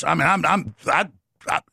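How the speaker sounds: ordinary talk, nothing marked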